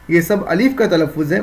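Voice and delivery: ordinary talk, nothing marked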